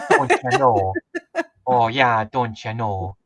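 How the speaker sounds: light folksy Minnesota lilt; a hint of Minnesota twang; Minnesota accent. Riffing on Louie Anderson's home state, Minnesota accent. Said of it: "Louis Anderson is from Minnesota".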